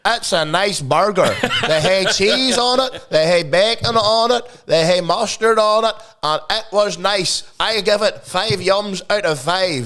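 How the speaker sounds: high, slow, sing-song review parody; mock food reviewer. Host parodying a burger joint reaction video, mock reviewer voice. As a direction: list it off like a simple-minded reviewer. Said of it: "Let, let's do a reaction".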